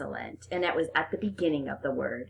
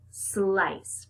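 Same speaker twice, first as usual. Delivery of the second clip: ordinary delivery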